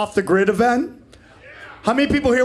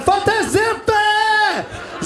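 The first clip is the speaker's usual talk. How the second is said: much higher, very slow, twisted shout of Zephyr; strangled French shout; 12 semitones higher, much slower than usual. Pretend. Comic at the airport sink, a strained high shout. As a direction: yell the name like a mangled French battle cry. Acting out his French character.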